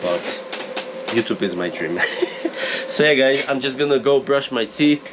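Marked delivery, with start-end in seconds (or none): none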